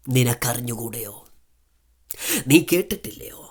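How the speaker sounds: hushed whispered question; whispered scripture question. Acted. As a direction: drop to a breathy whisper for effect. Scripture quote dropped to whisper.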